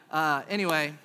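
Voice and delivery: normal talking voice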